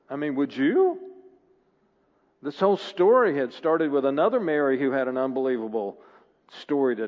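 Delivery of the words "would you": ordinary talk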